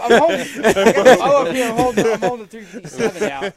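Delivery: loud, high, excited through laughter; laughing. Real, not acted. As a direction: tell it cracking up at yourself. Laughing while showing his stance.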